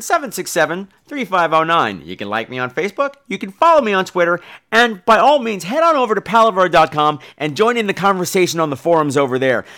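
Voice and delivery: ordinary talk, nothing marked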